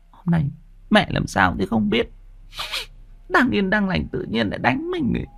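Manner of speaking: high, soft sulky daughter voice; a young girl grumbling softly, hurt; sniffling to herself. A narrator's reading. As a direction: mutter hurt to herself while walking. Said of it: "vừa đi vừa sụt sịt".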